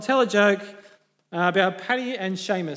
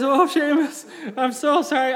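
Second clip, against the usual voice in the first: higher-pitched, lightly hammed-up apology; mock apology; 7 semitones higher, 4 dB louder than usual. Farmer apologises for his pig, mock contrite. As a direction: apologise profusely, playing it for laughs.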